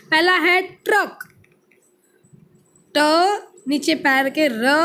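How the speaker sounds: ordinary talk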